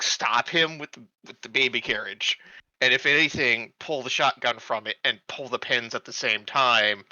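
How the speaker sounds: ordinary talk, nothing marked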